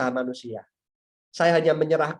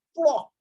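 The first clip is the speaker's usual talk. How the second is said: sudden vocal slap noise; abrupt mimicked smack; imitated slap; 11 semitones higher than usual. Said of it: "langsung ditampar sama papa saya".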